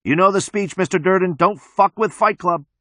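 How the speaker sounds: high, sustained warning yell; yelling from afar. A narrator's reading. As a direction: yell a reprimand from a distance. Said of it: "Somebody far, far away yells".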